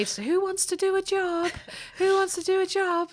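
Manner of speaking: high, theatrical teacher voice asking the class; mock teacher calling for a volunteer; dramatic teacher. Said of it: "She'd say".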